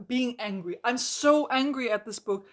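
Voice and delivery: ordinary talk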